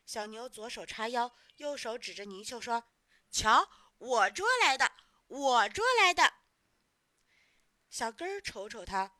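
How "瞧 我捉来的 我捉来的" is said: put-on loud, proud child's boast; boastful showing off. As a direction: show it off loudly, stressing your claim. Boy shows off his catch.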